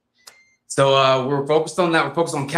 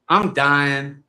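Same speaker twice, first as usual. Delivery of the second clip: ordinary delivery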